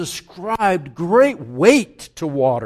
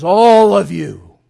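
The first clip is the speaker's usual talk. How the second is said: loud summons; 8 dB louder than usual. Acted. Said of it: "He says".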